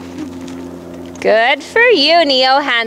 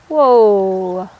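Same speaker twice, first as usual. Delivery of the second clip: calm horse whoa; 10 semitones lower than usual. Shown as a demonstration. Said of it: "Use your voice".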